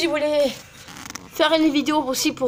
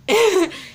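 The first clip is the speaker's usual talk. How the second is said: short laugh; 6 semitones higher, 4 dB louder than usual. Real, not acted. Laugh after joking.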